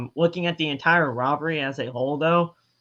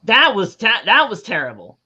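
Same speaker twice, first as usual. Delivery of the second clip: loud emphatic; 5 semitones higher, 8 dB louder than usual. Real, not acted.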